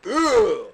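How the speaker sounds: loud, high mock bellow; Carnage's loud grunt. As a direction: bellow like your loudmouth friend. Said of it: "he's loud".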